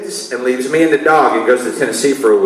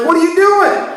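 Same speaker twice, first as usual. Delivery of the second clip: much higher, startled wife's question; shrill wife; 13 semitones higher than usual. Retold or imitated. Wife seeing towels thrown on the floor, high alarmed question.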